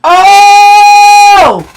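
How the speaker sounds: a sudden high, loud excited "Oh!"; excited shriek. Real, not acted. Streamer shrieks at her leaderboard rank, thrilled. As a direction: shriek with real delight.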